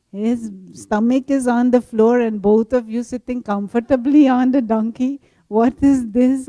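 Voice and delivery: loud outraged telling-off; indignant scolding. Retold or imitated. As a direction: scold him for mistreating the donkey. Fourth group scolds the loudest.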